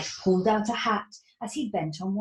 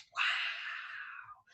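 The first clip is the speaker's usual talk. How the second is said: whisper; 11 dB quieter than usual. Acted. Granny's amazement inside shop.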